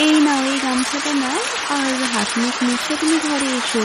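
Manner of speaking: a wife handing over a towel; busy housewife voice; mother's voice. Acted. Mother tends to soaked husband.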